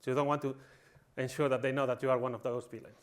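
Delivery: normal talking voice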